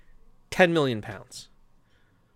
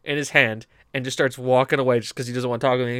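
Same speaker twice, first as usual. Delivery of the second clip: ordinary talk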